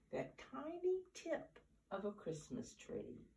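high, soft and small; tiny high voice. A narrator's reading. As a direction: make it sound tiny. Tiny mouse scene.

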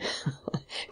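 soft, breathy chuckle; quiet laugh. A narrator's reading. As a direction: begin with a low little laugh under the breath. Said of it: "Don Luis began to laugh".

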